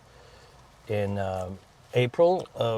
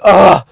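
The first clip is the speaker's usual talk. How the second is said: loud disgusted groan; disgusted ugh; 6 semitones higher, 15 dB louder than usual. Retold or imitated. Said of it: "he was like".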